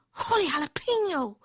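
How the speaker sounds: high-pitched kitten exclamation; exclaiming. A narrator's reading. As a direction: cry out in a small character voice. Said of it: "exclaimed Skippy John Jones".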